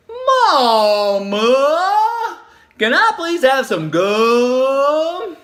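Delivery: put-on high, very slow, sugary little-girl plea; syrupy sweet plea. Child sweet-talking her mom for gum, syrupy sweet. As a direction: lay the sweetness on thick and slow. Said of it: "poured it on extra syrupy sweet".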